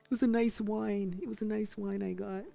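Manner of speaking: mocking relative voice. Imitating the relative, laughing.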